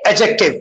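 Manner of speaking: "Adjective" as A-jek-tiv; silent D. Shown as a demonstration. Teacher showing how to pronounce it, "Adjective" with silent D. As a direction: leave the D out of "Adjective". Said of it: "Few more words with D".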